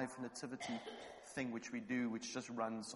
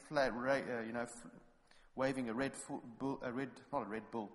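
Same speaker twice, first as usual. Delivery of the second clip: normal talking voice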